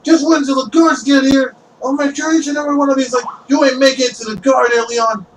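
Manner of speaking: high, hurried character voice; threatening townsperson. Acted. Townsperson warning the necromancer, high quick threatening voice. Mob member threatening the captive.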